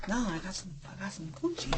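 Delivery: very soft, half-swallowed retold reply; imitating embarrassed admission. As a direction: let it out quietly as the sheepish son. Retold son's embarrassed admission to mom.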